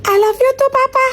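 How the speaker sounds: usual speaking voice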